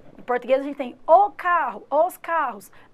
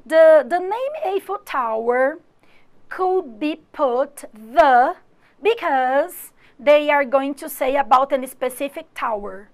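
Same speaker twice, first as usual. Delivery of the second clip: slow, louder exam-question reading; reading a test item; 6 dB louder, slower than usual. Shown as a demonstration. Teacher reads a simulated exam item to students, slow and loud. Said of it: "simular uma do ime".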